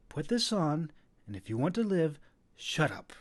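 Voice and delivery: instructing. A narrator's reading. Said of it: "she instructed".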